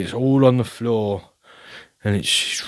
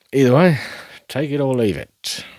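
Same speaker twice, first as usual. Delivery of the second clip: ordinary talk, nothing marked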